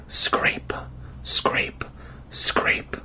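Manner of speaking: unvoiced creeping whisper. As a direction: breathe each scrape softly. Whispering scraping sounds.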